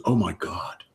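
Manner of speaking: hushed, shocked therapist whisper; whispered mock alarm. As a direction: breathe it like a scandalised therapist. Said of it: "the therapist goes".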